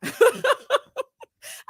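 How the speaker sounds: loud, high burst of laughter; helpless high laugh; cracking up. Real, not acted. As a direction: crack up at your own joke. Cracks up at own review.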